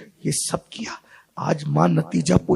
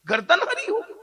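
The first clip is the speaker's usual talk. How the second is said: 1 high laughing take; 10 semitones higher than usual. Shown as a demonstration. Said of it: "repeats phrase, raised take".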